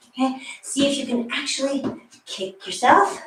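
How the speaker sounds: usual speaking voice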